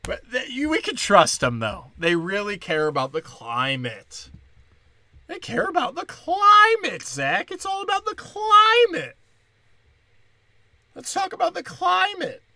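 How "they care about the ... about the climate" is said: very high mocking whine; sarcastic voice. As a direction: gush in fake earnest devotion. Sarcastic mock of climate people.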